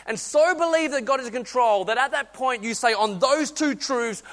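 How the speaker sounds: rousing sermon climax; fervent preaching. Acted. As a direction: preach it out with full force. Preaching climax at full force.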